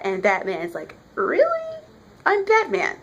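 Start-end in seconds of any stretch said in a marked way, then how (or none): 1.1-3.0 high, cocky disbelief; high-pitched mock indignation; incredulous Batman retort. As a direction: pitch it way up, as if nobody can stop you. Acted Batman defying the military.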